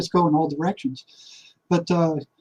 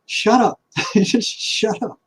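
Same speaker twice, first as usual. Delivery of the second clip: higher, then a slow laughing shut up; laughing brush-off; 4 semitones higher, slower than usual. Retold or imitated. Man telling his worried inner voice to stop, amused. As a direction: dismiss it with a chuckle, drawing out the last words slowly. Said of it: "I'm like".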